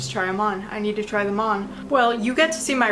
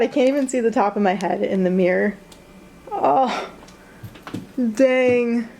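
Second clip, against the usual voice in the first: ordinary talk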